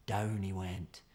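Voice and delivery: ordinary delivery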